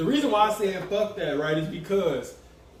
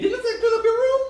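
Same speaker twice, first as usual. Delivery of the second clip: mock parent and kid voices; 3 dB louder, faster than usual. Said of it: "they sit on their motherfucking bed".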